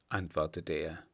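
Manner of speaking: usual speaking voice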